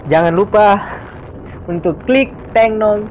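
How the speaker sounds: excited. Acted. Upbeat like-button call to viewers.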